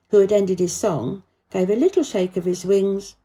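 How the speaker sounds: ordinary delivery, nothing marked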